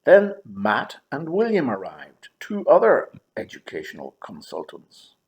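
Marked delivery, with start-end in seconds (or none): none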